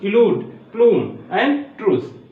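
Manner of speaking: इलूड, प्लूम, ट्रूस slow, each with pure oo; teacher's slow list, प्लूम as ploom; oo sound. Shown as a demonstration. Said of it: "ऊ का sense दे रहा है".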